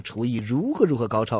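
normal talking voice

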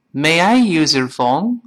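slow, careful teacher read. Shown as a demonstration. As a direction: read it out slowly for learners. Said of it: "我们慢慢读是这么来说的".

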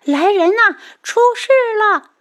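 high, loud cry for help; alarmed shout. A narrator's reading. Maid fleeing the pavilion, raising the alarm, shouting. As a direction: yell the alarm at the top of a high voice. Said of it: "边跑边叫".

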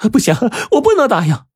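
high-pitched, hurried desperate outburst; distraught refusal. A narrator's reading. Mother jumps up to refuse the boss, distraught refusal. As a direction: blurt a frantic high refusal.

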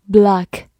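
block with an American open "ah" vowel; American "block", unrounded vowel; American pronunciation. Shown as a demonstration. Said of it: "美式发音读作".

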